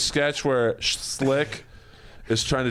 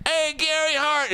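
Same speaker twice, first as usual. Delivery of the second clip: guy calling out. Retold or imitated.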